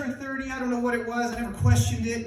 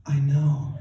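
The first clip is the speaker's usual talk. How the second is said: low, dry mother voice; mom's deadpan; 8 semitones lower, 4 dB louder than usual. Acted. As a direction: answer low and unimpressed. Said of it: "She says through the phone".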